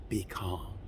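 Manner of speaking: a quiet, settled show of calm. Shown as a demonstration. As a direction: show what calm sounds like. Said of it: "See, when I'm calm".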